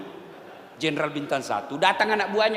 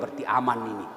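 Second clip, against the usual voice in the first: much lower and softer; quiet inner thought; 13 semitones lower, 6 dB quieter, faster than usual. Retold or imitated. Husband thinking quietly beside the well. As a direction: murmur it to yourself.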